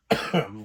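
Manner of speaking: sudden dry cough. Real, not acted. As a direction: cough mid-sentence. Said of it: "it just happened".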